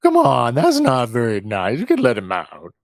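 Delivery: put-on slow, drawn-out drunken pleading; faked drunkenness. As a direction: keep up the drunk pilot act, words dragging. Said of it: "I'm still maintaining the".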